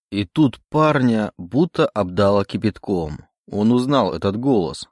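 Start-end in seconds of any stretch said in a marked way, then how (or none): none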